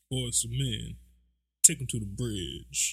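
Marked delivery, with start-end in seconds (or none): 1.6-2.9 low, slow crooner ad-lib. Pretend. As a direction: call it out like a nineties singer. Mock R&B singer ad-lib.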